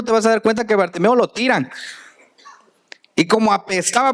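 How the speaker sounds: ordinary delivery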